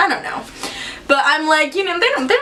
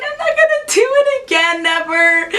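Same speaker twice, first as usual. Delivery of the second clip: high, pleading cry; retold tearful promise; 8 semitones higher than usual. Daughter swearing to her dad she won't ditch again, high and tearful. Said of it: "I was crying".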